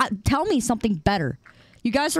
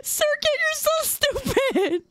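much higher, slow squeaky scolding; squeaky mock voice; 13 semitones higher than usual. Scolding the chat kid in a high, slow squeak. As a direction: squeak it out slowly like a cartoon kid.

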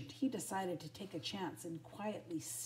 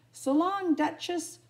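high, loud farewell call; big storybook shout; crying out; 10 semitones higher, 10 dB louder than usual. A narrator's reading. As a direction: holler goodbye across the pound. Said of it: "cried the dogs".

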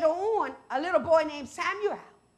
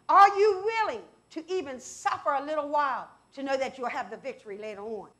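ordinary talk

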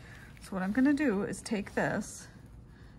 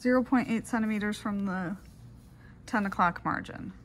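dictating. Shown as a demonstration. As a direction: dictate it clearly into the recorder. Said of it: "I will go ahead and do those steps in a dictation format".

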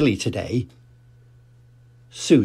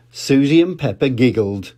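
ordinary delivery, nothing marked

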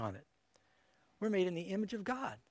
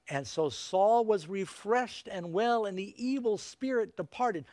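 louder, slow Bible reading; fervent scripture reading; 3 semitones higher, 5 dB louder, slower than usual. Acted. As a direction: proclaim the passage with force. Reads First Samuel aloud.

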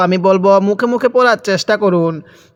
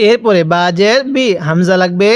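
usual speaking voice

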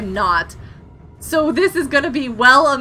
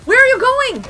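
high, loud amazed exclamations; startled excitement; 7 semitones higher, 4 dB louder than usual. Real, not acted. Player calls out to her sinking new submarine, startled and excited. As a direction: cry out in real astonishment, higher and louder.

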